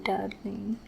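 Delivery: breathy whispered darling. Acted. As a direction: breathe the word close to the ear. Whispered pet name after vow.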